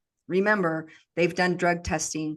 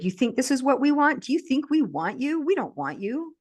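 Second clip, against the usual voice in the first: higher-pitched, mocking disbelief in a retold voice; retold scornful taunt; 7 semitones higher than usual. Quoting the captors' scornful taunt.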